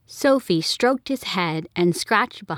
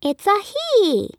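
put-on high, slow child's voice; a small girl, earnest and careful; much higher kid voice, slowed; little girl correcting; 13 semitones higher, slower than usual. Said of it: "Sophie said".

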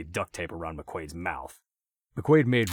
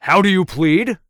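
much higher and louder demand; mock judge; 13 semitones higher, 17 dB louder than usual. A narrator's reading. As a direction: bark it like a crazed judge.

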